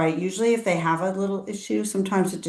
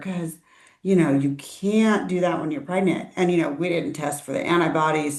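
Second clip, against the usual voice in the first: ordinary delivery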